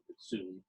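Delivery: "sum" closed on the M with no final vowel, softly; the right "sum" ending on M, quiet; correct form sum. Shown as a demonstration. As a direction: stop cleanly on the M of "sum". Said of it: "it's not sumo".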